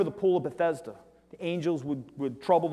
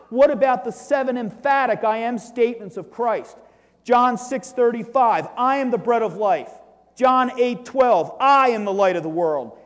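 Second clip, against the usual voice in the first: preaching at full force; 6 semitones higher, 9 dB louder than usual. Acted. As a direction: proclaim each verse like a call from the pulpit.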